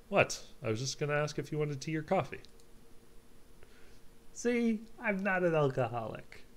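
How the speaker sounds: high-pitched, indignant mock defence; hammed-up high defensive voice; defensive protest. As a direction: protest your innocence, pitched right up. Character defends himself when challenged.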